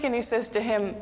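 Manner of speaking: ordinary delivery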